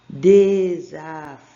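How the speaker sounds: "Desafio" loud and stretched, syllable by syllable; "Desafio" drawn out as de, za, fi, o. Shown as a demonstration. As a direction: sound out "Desafio" slowly and loudly. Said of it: "Segunda palavra aqui".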